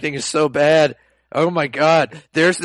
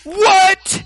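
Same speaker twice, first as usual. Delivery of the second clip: very high, loud squealed "What?"; startled mock schoolgirl squeal; 7 dB louder than usual. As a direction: shriek it like the little girl. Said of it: "she goes".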